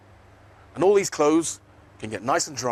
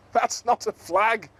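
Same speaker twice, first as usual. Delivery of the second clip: giggled; 7 semitones higher, slower than usual. Acted. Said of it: "giggled Zippity".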